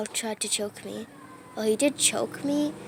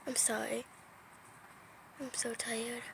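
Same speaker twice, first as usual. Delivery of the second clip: ordinary talk, nothing marked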